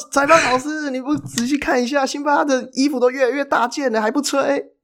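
high, mock-polite heckle at the ref; heckling fan. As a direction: needle the referee in a high, sing-song voice. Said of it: "说".

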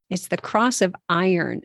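normal talking voice

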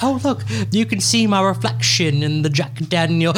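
mock delight with a laugh creeping in. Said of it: "And she's like".

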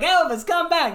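high mock call after a friend. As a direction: call him back in a squeaky put-on voice. Friends calling after [name].

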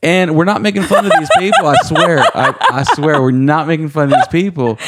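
laughing. Real, not acted. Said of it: "laughing protest".

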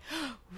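quick soft gasp of realization; sharp little intake of breath, acted out; retold gasp.

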